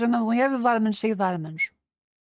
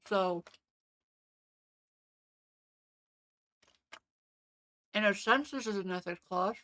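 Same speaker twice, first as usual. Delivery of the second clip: talking with sticky peanut butter in mouth; mouth full of peanut butter; 7 dB quieter than usual. Real, not acted. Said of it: "Sorry, the peanut butter is very sticky".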